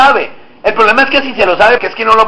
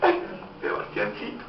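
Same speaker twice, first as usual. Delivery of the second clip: very soft, low, mournful murmur; sad tone; 8 semitones lower, 20 dB quieter than usual. Shown as a demonstration. Preacher demonstrating tone to the congregation, sad. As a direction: sigh it out sadly and weakly. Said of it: "el tono es de qué? Tristeza".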